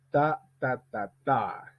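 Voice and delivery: slow "Ta ta ta TA", last beat lengthened; final-stress rhythm drill. Shown as a demonstration. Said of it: "Entraînement avec un mot isolé". (« Ta ta ta TA » lent, accent sur le dernier)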